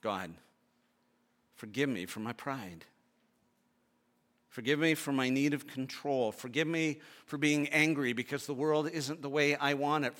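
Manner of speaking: quiet prayer of confession. Real, not acted. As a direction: pray it humbly, softly. Preacher prays aloud in sermon.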